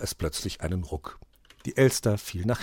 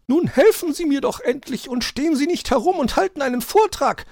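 very high, loud scolding rush of words; nagging magpie; 8 dB louder than usual. A narrator's reading. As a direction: nag for help in a high bird voice. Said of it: "Elster dumpf unter dem Schnee zetern". (zeternde Elster, sehr hoch und laut)

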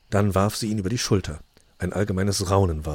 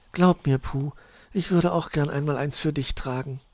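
higher, changed flirty voice; Luzi's voice; 7 semitones higher than usual. Acted. Luzi flirting with Puh about a wedding dress, higher voice. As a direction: tease him sweetly in a higher voice.

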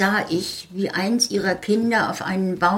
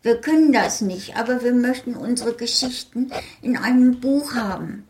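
higher, eager little-folk voice; high eager request; little folk pleading; 7 semitones higher than usual. Acted. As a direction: beg sweetly like small fairy people. Little people begging for book.

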